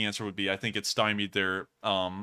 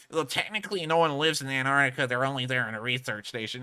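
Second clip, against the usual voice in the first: mock know-it-all. Pedantic chat commenter correcting the host, mildly mocking. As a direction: poke fun at a nitpicking commenter. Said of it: "sits there and pushes their glasses up on their gu-, on their nose and goes".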